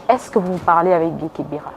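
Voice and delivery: usual speaking voice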